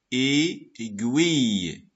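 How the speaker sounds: "aiguille" slowly split into "ai" and "guille"; syllable by syllable. Shown as a demonstration. Teacher showing first and second syllable, "aiguille" slowly separated. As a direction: draw out both syllables of "aiguille". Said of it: "Voilà la première syllabe".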